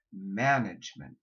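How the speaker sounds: Management with a low short a before the N; vowel kept low. Shown as a demonstration. Coach shows students the low a before N. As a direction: keep the first a of Management down. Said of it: "Keep it down".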